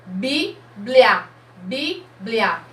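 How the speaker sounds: Bíblia as BI, pause, BLIA; syllable by syllable. Shown as a demonstration. Teacher counting mouth openings for children, syllable by syllable. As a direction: say Bíblia twice in two separate beats. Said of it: "Quantas vezes eu abro a boca".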